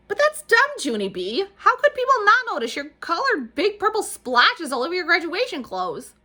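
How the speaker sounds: high, loud sneering voice; mock her with a superior sneer; snooty little girl. A narrator's reading. Lucille taunting Junie about her splotches, high and loud.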